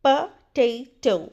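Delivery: "पोटैटो" slowly, puh, TAY, toh; correct pronunciation, syllabified. Shown as a demonstration. Teacher repeating for learners, slow syllable-by-syllable "पोटैटो". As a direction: break "पोटैटो" into three slow separate syllables. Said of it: "correct pronunciation है".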